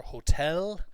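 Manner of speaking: HOTEL stressed on the second syllable; correct stress. Shown as a demonstration. Teacher modelling a problem word for class, stressed on TEL. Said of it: "Other pronunciation issues that came up".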